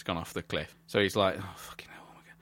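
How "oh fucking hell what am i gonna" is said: climber cursing under his breath; very soft, whispered acted panic; whispered mock cursing. Voicing stranded climber muttering to himself.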